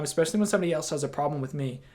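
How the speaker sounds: ordinary talk, nothing marked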